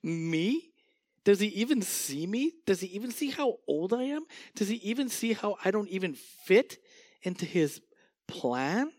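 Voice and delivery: high incredulous scoff; scoffing Sarah. Acted. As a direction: play Sarah scoffing at the promise. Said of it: "picture her kinda scoffing".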